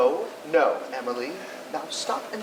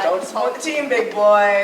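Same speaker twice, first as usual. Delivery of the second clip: jeering heckle; 7 dB louder than usual. Acted. Heckler at the wedding.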